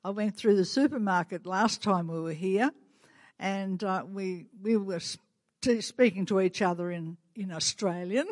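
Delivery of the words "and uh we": ordinary talk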